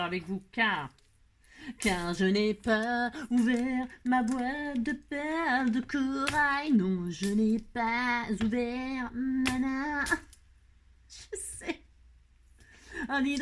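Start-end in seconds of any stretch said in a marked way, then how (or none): 1.8-10.0 slow, playful, drawn-out teasing; teasing sing-song. Pretend. Teasing the viewers about the box. 11.1-11.8 whispered playful aside; whispering. Pretend. Secret aside.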